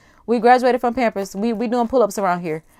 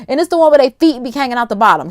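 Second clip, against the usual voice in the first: usual speaking voice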